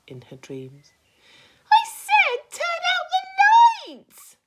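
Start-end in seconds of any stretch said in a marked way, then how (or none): 1.7-4.4 high, loud and slow furious centipede; angry shout. Acted. Centipede shouting angrily at the glowworm. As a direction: bellow the order at the ceiling. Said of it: "shouted Centipede angrily".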